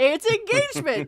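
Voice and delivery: high, loud punchy answer; emphatic answer. Retold or imitated. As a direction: deliver the obvious answer with gusto. Gleeful chant answering own question.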